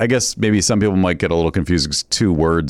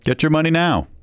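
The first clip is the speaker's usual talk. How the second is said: ad tagline; 9 semitones higher than usual. Acted. Ad tagline response.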